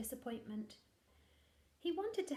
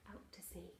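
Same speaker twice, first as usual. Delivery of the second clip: whisper; 5 semitones lower, 14 dB quieter than usual. Acted. Storybook line dropped to hush.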